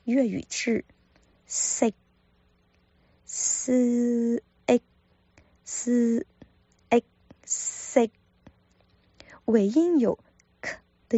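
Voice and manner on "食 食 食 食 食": 食 with a clipped unreleased k; Cantonese sik. Shown as a demonstration. Teacher repeating 食 for learners, unreleased ending. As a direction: stop the air at the end of 食. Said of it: "这个音呢叫做食".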